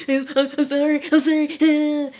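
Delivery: high, loud imitated wailing apology; mock freak-out. Tied-up Craig pleading for mercy, mock panicked wail. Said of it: "Craig is freaking out".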